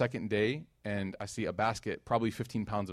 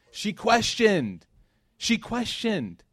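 "She questioned" slow, higher and louder; stress on questioned; 10 semitones higher, 4 dB louder, much slower than usual. Shown as a demonstration. Preacher drives home the answer to his congregation, slow and emphatic. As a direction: land "questioned" hard and slow. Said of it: "preacher stresses the answer".

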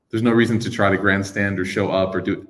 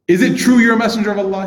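insistent question; 11 semitones higher, 6 dB louder than usual. Retold or imitated. Bedouin question.

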